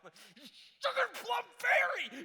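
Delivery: high, drawn-out shout of a made-up swear; mock cursing. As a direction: explode into a hammed-up, squeaky-clean curse. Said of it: "I'm making up cuss words".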